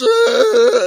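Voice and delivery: high, loud imitated shriek; mock scream. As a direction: let out her shriek, hammed up. Girlfriend voiced in retold story.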